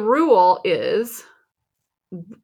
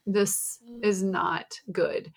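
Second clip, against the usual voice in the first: soft, slow, tired deadpan; mock-grim realization, slowed down; weary dread; 8 dB quieter, slower than usual. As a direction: sink into quiet resignation. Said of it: "And I am just like".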